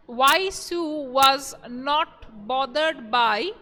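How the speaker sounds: slow, drawn-out phrases with long gaps; teacher piecing out a question slowly; dictation. Acted. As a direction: dictate slowly for students writing it down.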